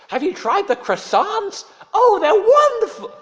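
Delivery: very high, loud, gushing American voice; booming cheery tourist greeting the waiter. Retold or imitated. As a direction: ham up an overfriendly tourist. Friends gush about croissants.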